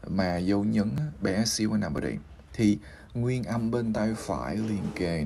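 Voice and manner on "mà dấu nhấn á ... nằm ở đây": usual speaking voice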